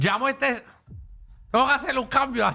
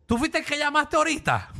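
higher-pitched retold questioning; imitated shop employee; 6 semitones higher than usual. Employee confronts the returning caller, mimicked in a raised voice. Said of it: "el tipo me mira y me dice".

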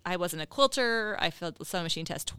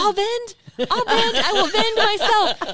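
much higher and louder, laughing eagerness; eager volunteering; 12 semitones higher, 9 dB louder, slower than usual. Retold or imitated. Young quilter jumping at vendor chance, loud eager squeal. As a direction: blurt it out like a thrilled kid. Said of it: "And I'm like".

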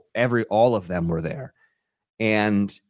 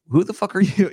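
higher-pitched mock challenge to a stranger; mock confrontational; 10 semitones higher, 3 dB louder than usual. Celebrity to his friend's unknown date, mock confrontational. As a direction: imitate a celebrity brusquely sizing someone up.

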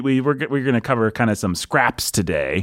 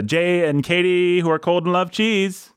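metrical scansion; 7 semitones higher than usual. Shown as a demonstration. Testing the line for pentameter.

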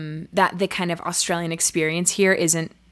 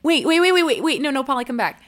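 high, loud, rapid-fire waits; urgent, insistent plea to wait; urgent calling out; 7 semitones higher, 3 dB louder, faster than usual. Acted. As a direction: stop someone who is walking off. Calling departing coworker back.

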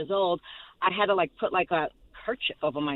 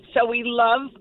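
ordinary talk

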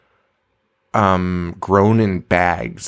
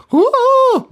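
very high, loud falsetto whoop; 5 dB louder than usual. Retold or imitated. Podcaster mimics the dean from the movie, high falsetto impression. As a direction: let out a piercing, high-pitched warble. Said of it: "doing the [name] impression".

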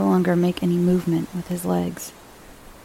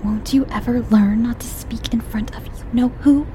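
cross, hushed scolding; cross whisper; 5 semitones higher than usual. A narrator's reading. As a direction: hiss a cross rebuke. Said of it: "said Mummy crossly".

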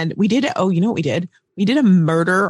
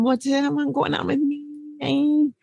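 drawn out question; 7 semitones higher, 4 dB quieter, much slower than usual. Acted. Teasing hesitation.

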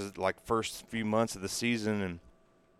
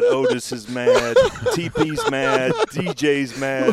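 laughing; 5 semitones higher, 9 dB louder, slower than usual. Real, not acted. Listing angry teammates, laughing.

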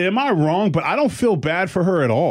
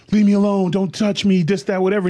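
retold scream; 6 semitones higher than usual. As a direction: half-shout it as if screaming. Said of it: "Screaming her at the top of her lungs".

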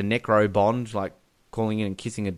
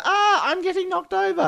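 high, laughing hammed-up damsel cry; mock damsel. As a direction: play the helpless girl for laughs. Mocking Denise Richards falling.

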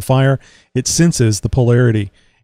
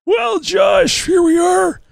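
shouting; much slower than usual. Pretend. Shouting to deaf old man.

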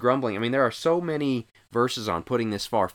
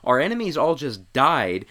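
usual speaking voice

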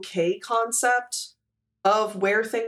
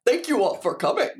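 Sam the Eagle voice; 3 semitones higher, faster than usual. Acted. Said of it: "in the Sam the Eagle voice".

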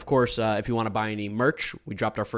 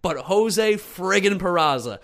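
slow, drawn-out buildup; excited; 7 semitones higher, 5 dB louder, slower than usual. Real, not acted. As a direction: draw it out for effect. Building to hero's name.

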